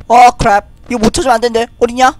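normal talking voice

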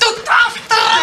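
high, drawn-out angry scream. Acted. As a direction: scream at him in outrage. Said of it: "Nicht schreien, Frau Silvia".

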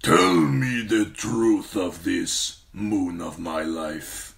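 slow, heavy warlord command; commanding khal. Acted. Khal demanding the truth from his wife, slow command. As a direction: command her slowly, like a warlord. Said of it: "he commanded in Dothraki".

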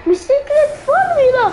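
high, fast squeaky villain. Acted. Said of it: "repeated in a different voice".

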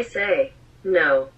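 ordinary talk

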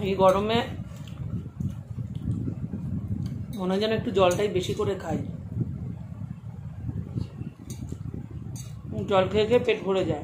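chewing while talking; eating rice by hand; mouth full of rice. Real, not acted. Seen 0: Mixes rice by hand, feeds herself at 6.7, chews while talking.

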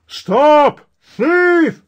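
high, loud shout of a distant mob. A narrator's reading. Mob shouting at the thief, a high loud yell. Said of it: "they heard a shout of".

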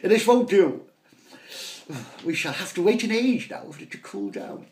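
high, loud outraged gentleman; hammed-up aristocratic outrage; indignant posh shout. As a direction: bluster about the truffles' temperature. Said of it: "shouted Pater".

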